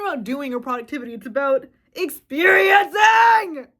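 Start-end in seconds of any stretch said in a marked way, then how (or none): 1.1-3.7 slow, loud, high shout breaking into a cry; raging, near-crying shout. Real, not acted. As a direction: let the rage break your voice. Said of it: "I am raging right now".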